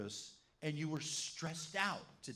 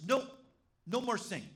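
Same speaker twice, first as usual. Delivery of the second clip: a hint of a bossy small boy, louder; little boy's mock order; 7 semitones higher, 5 dB louder, faster than usual. Little boy telling Grace to stop singing, mock bossy. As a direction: play the boy shutting down the singing. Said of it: "he goes to her and says".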